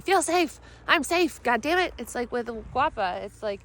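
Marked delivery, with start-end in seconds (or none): 0.0-1.9 higher, louder mock-frustrated command; hammed-up forcing yourself to feel safe. Ordering her body to feel safe, mock frustrated. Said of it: "it's not gonna work by saying".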